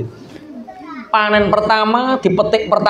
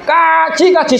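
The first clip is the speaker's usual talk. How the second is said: high shouted chant; 9 semitones higher, much slower than usual. Acted. Workers in the field shouting the word in unison. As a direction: yell it out together.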